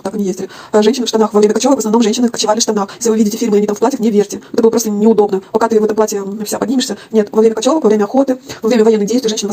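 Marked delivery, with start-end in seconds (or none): none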